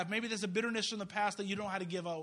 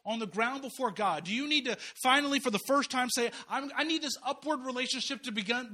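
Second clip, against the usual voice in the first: louder, higher, urgent altar call; preaching at full force; 5 semitones higher, 5 dB louder than usual. Acted. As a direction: push the congregation toward decision. Altar call, rising intensity.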